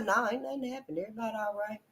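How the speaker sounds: muttered denial; soft and dismissive; quiet mock denial. Quoting the sister's denial.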